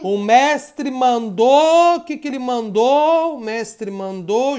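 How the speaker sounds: very slow, stretched-out announcement; drawn-out game call. Pretend. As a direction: stretch each word to build suspense. Anuncia a ordem do jogo. (chamada de jogo bem arrastada)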